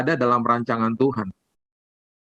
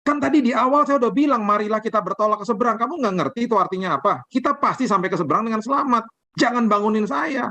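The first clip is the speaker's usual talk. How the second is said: stern rebuke; 8 semitones higher than usual. Retold or imitated. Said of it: "dengan keras".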